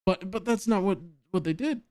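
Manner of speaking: ordinary talk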